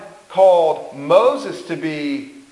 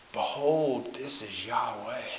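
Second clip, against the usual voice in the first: hushed announcement; 7 semitones lower, 14 dB quieter than usual. Retold or imitated. Aaron presenting the golden calf.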